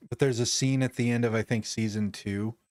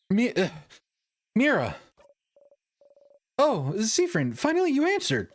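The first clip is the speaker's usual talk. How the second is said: lightly voiced anxious young woman, higher; a friend checking on him, concerned; worried cheerful girl; 12 semitones higher than usual.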